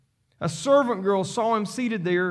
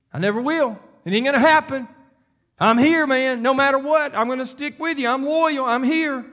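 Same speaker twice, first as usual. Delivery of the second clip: Peter's loud vow; 6 semitones higher, 5 dB louder than usual. Acted. Preacher voicing Peter to his congregation, loud earnest vow. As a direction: raise the voice high and loud, swearing you'll never leave.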